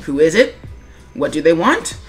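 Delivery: ordinary talk, nothing marked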